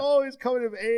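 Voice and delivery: high, whiny mock sob; put-on high-pitched blubbering; mock weepy. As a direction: gush tearfully, hammed-up. Mock weeping over teen rom-com.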